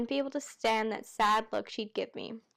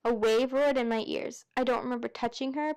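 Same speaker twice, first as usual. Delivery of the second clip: usual speaking voice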